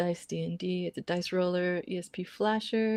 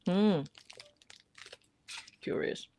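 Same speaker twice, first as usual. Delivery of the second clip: chewing while talking; 5 dB quieter, slower than usual. Real, not acted. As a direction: mouth full of cheese. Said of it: "Pardon my cheese noises".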